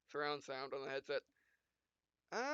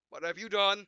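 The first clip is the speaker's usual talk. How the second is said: mock alarm; 5 semitones higher, 12 dB louder than usual. Hero startled by the sudden sound.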